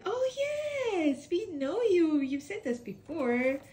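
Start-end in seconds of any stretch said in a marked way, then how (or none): none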